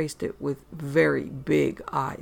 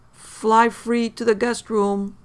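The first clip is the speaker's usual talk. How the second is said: slow urging; 6 semitones higher, 6 dB louder, much slower than usual. Acted. Coaxing mother off the ledge.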